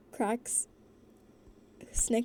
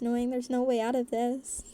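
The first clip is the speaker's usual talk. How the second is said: ordinary delivery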